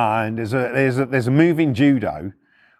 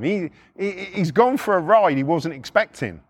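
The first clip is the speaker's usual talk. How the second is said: usual speaking voice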